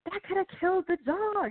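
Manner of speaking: high, scandalized protest; high mock outrage; appalled editor. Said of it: "she was so like".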